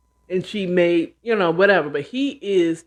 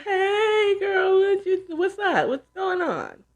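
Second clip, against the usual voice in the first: much higher, sugary put-on friendliness, falling back down at the end; fake cheerful; 13 semitones higher, slower than usual. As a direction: pretend to be glad to hear from her. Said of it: "trying to fake like she's".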